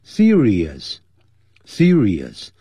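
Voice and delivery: "Serious" very slow, every syllable crisp; clear careful pronunciation. Shown as a demonstration. Teacher drilling "serious" slowly for the class. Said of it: "要念得很清楚".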